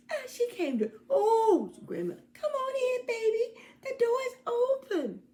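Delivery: very high, quavering granny voice; a very high, delighted grandma calling come in; excited granny. Acted. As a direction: welcome her with a thrilled old voice. Granny welcomes visitor.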